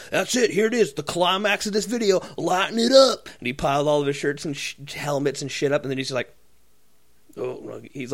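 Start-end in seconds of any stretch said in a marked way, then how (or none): 0.1-3.3 imitating the video guy.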